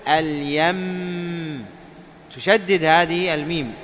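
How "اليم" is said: اليم very slow, doubled mim hummed for two counts; slow tajweed stop on اليم; ghunna on the mim. Shown as a demonstration. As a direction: hold the mim of اليم nasal and long. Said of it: "ماذا تقول؟".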